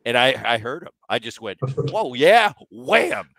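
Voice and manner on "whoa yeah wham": loud, higher cry of triumph; excited. Retold or imitated. Brother reliving the punch that hurt Aaron, loud and excited. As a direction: blurt it out like the punch just landed.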